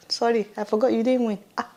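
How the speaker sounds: ordinary talk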